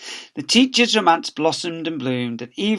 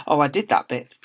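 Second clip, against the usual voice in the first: ordinary delivery, nothing marked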